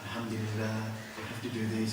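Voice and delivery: soft, calm and settled; calm Gujarati mode. Retold or imitated. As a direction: keep it gentle and soft. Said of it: "I was in Gujarati mode".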